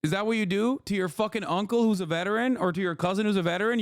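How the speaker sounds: ordinary talk, nothing marked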